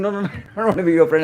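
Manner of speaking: quick, laughing no no no; light laughing protest of a humble servant; laughing refusal. Retold or imitated. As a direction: brush off the offer with a laugh, fast. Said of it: "a friend who's saying".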